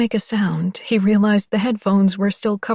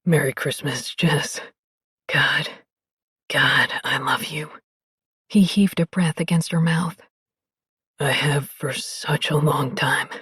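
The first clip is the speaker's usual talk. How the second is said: low, soft, devoted man; tender low voice; 5 semitones lower, 3 dB quieter than usual. Acted.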